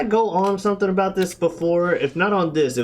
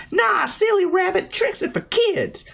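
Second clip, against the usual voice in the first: much higher, sing-song taunt; taunting kids; 13 semitones higher than usual. Retold or imitated. Kids taunting the rabbit, high sing-song mock voices. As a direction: tease him like a smug child.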